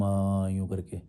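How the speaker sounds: hammed-up calm of a holy man; mock serene calm. Shown as a demonstration. Mocking the serene sadhu voice critics expect. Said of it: "सच्चे आध्यात्मिक होते हैं तो शांत होते हैं एकदम".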